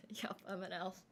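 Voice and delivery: soft, unvoiced whisper; hushed confession; whispered aside. Real, not acted. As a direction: let it slip out as a secret joke. Aside about making up Finnish.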